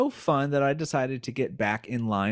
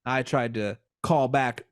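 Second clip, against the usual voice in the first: ordinary talk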